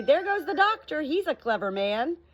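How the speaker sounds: high, loud call of a townsperson; admiring townsfolk. Acted. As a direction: sing out his praise. Townsfolk voiced in story.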